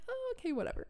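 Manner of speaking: high, brushed-off mock okay whatever; dismissive whatever. Skeptical teen brushing off the welcoming leader, dismissive. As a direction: wave him off with a sing-song shrug.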